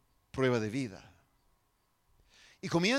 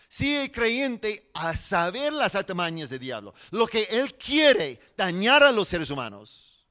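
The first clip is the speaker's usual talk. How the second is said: forceful preaching, loud and raised in pitch; prédica enérgica; 5 semitones higher, 7 dB louder than usual. Acted. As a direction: preach it out to the whole congregation. (predicación enérgica en voz alta)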